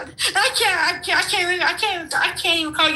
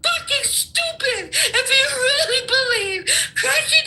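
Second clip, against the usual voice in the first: high, tearful laughing voice; laughing disbelief; 6 semitones higher than usual. Real, not acted. Guest telling host he's stupid, laughing near tears. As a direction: insult him through helpless laughter. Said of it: "I'm laughing because you're stupid".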